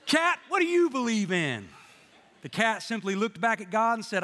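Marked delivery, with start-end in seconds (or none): 0.0-1.7 higher, somewhat louder questioning; raised-voice demand. Acted. God questioning the cat at heaven's gate, raised voice. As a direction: call the cat out with a mock-stern question.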